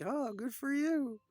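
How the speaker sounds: high, loud, drawn-out response; exaggerated high-pitched reply; mock townsfolk reply. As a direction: stretch it out as a comic bystander.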